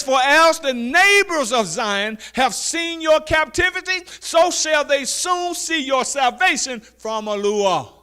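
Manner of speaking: high, loud, slow preacher's call; preaching scripture. Acted. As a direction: proclaim it to the congregation. Scripture read at full force.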